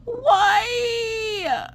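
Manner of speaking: very high, long crying wail; despairing wail. Retold or imitated. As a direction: howl it out in mock agony. Despair at indecisive son.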